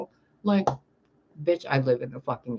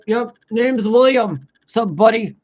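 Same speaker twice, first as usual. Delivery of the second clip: slow, louder put-on dude voice; mock man's voice; 6 semitones higher, 7 dB louder, slower than usual. Passing the old man, slow put-on guy voice. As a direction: play a laid-back guy named William. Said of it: "I popped out my Adam's apple".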